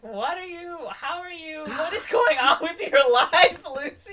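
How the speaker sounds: high-pitched, incredulous retelling; mock exasperation. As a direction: pile up baffled questions, breaking into laughter. Acted friend, baffled by the confession.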